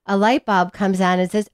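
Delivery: ordinary delivery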